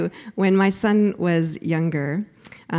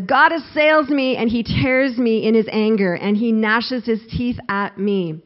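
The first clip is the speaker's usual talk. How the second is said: raised, louder voice of accusation; forceful, acted accusation; angry accuser; 3 semitones higher, 5 dB louder than usual. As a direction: act out an angry charge against God. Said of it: "Job also is an angry accuser".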